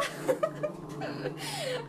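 high squealing giggle of delight; giddy high laugh. Real, not acted. Painter laughs giddily to her live viewers. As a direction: laugh like you can't contain it.

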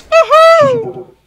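very high, loud whoop; gleeful woohoo. Real, not acted. Gamer celebrating a shot in the face, gleeful high whoop. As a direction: whoop in falsetto with delight.

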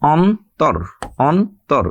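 অন্তর as two separate syllables, অন and তর; split into syllables. Shown as a demonstration. Teacher points out closed syllables, অন্তর said in two pieces. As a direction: say অন্তর with a gap between অন and তর. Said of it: "তাহলে এখানে অক্ষরের সংখ্যা ছয়টি".

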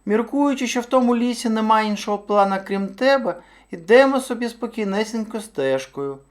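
high-pitched storybook hare; hare telling his tale. A narrator's reading. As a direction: recount it as a small anxious hare.

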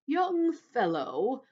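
very slow, weighty, scornful grasshopper; deep, slow, scornful. A narrator's reading. Old grasshopper putting down the centipede, slow and scornful. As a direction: stretch each word with loud scorn. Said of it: "speaking in a deep, slow, scornful voice".

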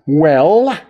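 loud high sharp demand. A narrator's reading. Teacher losing patience with Percy, loud sharp demand. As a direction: bark it as a demand. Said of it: "she demanded".

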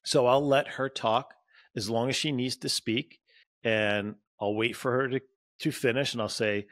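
ordinary talk

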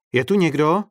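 loud anybody-there shout; shouted call. A narrator's reading. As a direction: call out into an empty tent. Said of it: "křikl".

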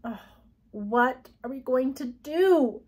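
miserable. A narrator's reading. Girl stuck with unwanted guest, miserable. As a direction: draw the question out helplessly. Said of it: "she asked miserably".

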